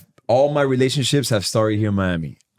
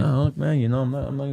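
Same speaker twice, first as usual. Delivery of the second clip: higher-pitched, halting retold protest; shy denial; 6 semitones higher than usual. Thirteen-year-old denying to his uncle he was looking, shy. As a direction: stammer a bashful no. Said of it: "shy at that".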